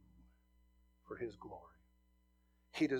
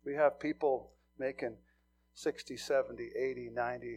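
normal talking voice

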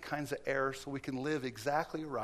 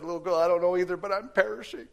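loud, higher, broken man pleading; desperate plea; 5 semitones higher, 9 dB louder, faster than usual. Retold or imitated. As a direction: beg as one who hasn't slept. Sleepless man returns, desperate.

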